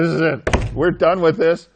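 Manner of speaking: loud, fed-up declaration; exasperated priests. Acted. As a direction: slam the door on it, loudly. Priests fed up, voiced loudly.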